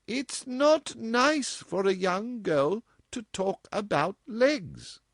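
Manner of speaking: slow, high, prim mother; a little prim. A narrator's reading. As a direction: pause slightly before about legs. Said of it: "a little primly, slightest possible pause".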